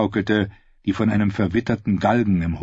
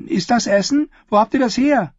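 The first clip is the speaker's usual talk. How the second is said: put-on higher girl's voice, businesslike; a girl on the porch sizing up strangers, higher and guarded; wary ten-year-old Willow; 4 dB louder than usual. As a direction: sound like a watchful child running the inn. Willow asks eagerly.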